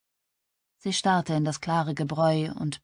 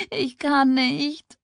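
high, drawn-out sob; sobbing; 9 semitones higher, 4 dB louder, much slower than usual. A narrator's reading. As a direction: wail it slowly, choked by acted tears. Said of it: "schluchzte sie". (schluchzend, gedehnt)

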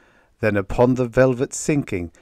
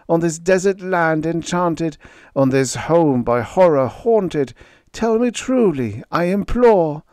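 desperate imploring; 10 semitones higher, 6 dB louder than usual. Acted. Narrator begs the raven.

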